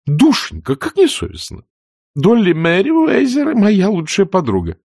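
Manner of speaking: put-on high, fluttery elderly aunt voice, shocked; fussy aunt scolding. Pitty protests. (высокий суетливый голос тётушки, возмущённо)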